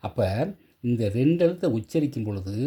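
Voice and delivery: ordinary talk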